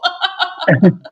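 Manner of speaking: high burst of laughter; loud laugh. Real, not acted. Laughs after calling critic bitch.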